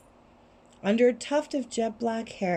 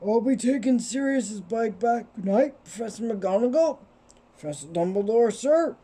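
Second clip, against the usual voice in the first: muffled voice. Acted. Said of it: "in a very muffled voice".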